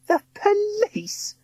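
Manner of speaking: high, slow sneer; drawn-out scornful question. A narrator's reading. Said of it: "Missus Croker sneered".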